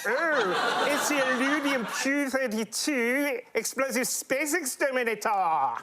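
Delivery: high cartoon Martian voice; Marvin the Martian. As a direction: show off a hammed-up little alien. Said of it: "what weapon Marvin the Martian was using".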